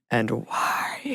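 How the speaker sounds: hushed, eerie whisper to tease the mystery; spooky whisper. Pretend. Host teasing listeners about the haunted house, spooky whisper. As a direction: breathe it out like a ghost story. Spooky Halloween topic announced.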